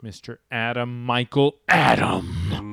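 slow, loud, grand announcement of a name; grand introduction. Acted. Host introducing his co-host, slow grand announcer voice. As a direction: present him like a boxing announcer.

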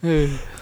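high cracked-up laugh; high burst of embarrassed laughter; helpless giggle. Real, not acted. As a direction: lose it laughing. Failed accent attempt, cracks up.

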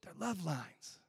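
hushed revelation, soft and breathless; whispered realization. Retold or imitated. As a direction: whisper it like a discovery. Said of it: "I was like".

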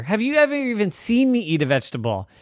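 fast, high, loud retort; indignant denial. Acted. Daddy defending himself to Rose, indignant. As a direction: throw the accusation back incredulously.

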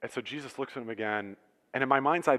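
ordinary talk

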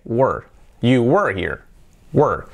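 "were" as just W and a strong r, "wur"; low falling "were" with no e sound; W plus R. Shown as a demonstration. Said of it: "el sonido W y la R". ("were" solo con W y R, tono bajo descendente)

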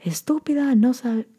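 mock a harsh immigrant parent's scolding. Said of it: "very, very harsh".

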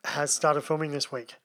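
usual speaking voice

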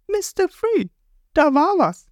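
put-on very high girlish character voice; Golden Week's voice. A narrator's reading. Golden Week alerts Mister Free, high cartoon girl voice. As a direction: pipe it up like a small cartoon girl. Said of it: "Golden Week sagt".

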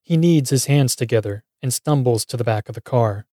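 usual speaking voice

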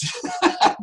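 short real chuckle; quick laugh. Real, not acted. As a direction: laugh in amused disbelief. Amused by free money.

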